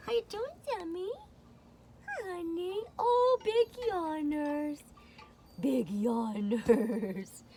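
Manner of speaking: high soft cooing at the cat. Real, not acted. Soft baby talk to cat.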